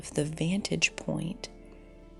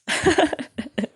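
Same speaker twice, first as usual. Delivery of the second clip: sudden loud laugh at herself; loud burst of laughter; 10 semitones higher, 9 dB louder than usual. Real, not acted.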